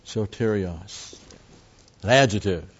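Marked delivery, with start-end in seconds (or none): 0.0-1.2 Soterios spelled out slowly, soh-TEH-ree-os, each syllable separate; a teacher sounding out Soterios for the class; slow pronunciation. Shown as a demonstration. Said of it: "The word is soterios".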